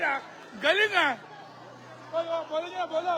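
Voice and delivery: normal talking voice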